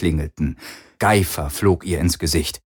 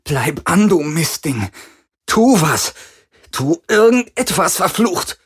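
frantic, much higher shouting; angry; 9 semitones higher than usual. Acted. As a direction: curse at it in panic. Yelling at failing device.

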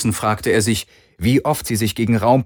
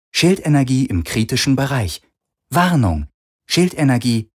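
ship computer voice; 3 semitones higher than usual. A narrator's reading. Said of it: "meldete das Schiff".

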